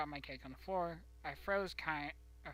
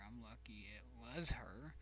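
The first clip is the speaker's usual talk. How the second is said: whispering; 6 semitones lower, 8 dB quieter than usual. Real, not acted. Whispered aside.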